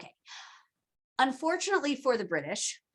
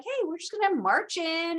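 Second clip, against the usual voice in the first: mock British; 8 semitones higher than usual. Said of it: "So they were like".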